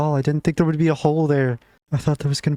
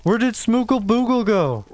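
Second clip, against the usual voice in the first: calling for a lost pet; 10 semitones higher than usual. Real, not acted. Looking for his lost pet.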